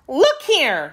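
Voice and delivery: put-on high, stern little girl. A narrator's reading. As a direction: call them sharply to order. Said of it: "said Dorothy sternly".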